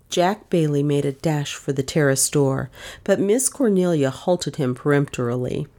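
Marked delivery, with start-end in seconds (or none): none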